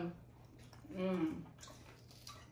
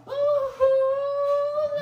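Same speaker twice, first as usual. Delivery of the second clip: a big bright gasp of delight; very high, loud oooh; excited ooh; 13 semitones higher, 7 dB louder than usual. Real, not acted. Showing fruit to baby.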